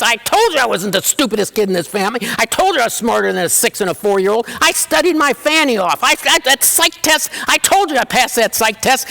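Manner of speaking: gloating excitement. Retold or imitated. Son bragging to his father about grades, giddy and excited. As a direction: crow about the grades like you've won. I jumped up and did a jig.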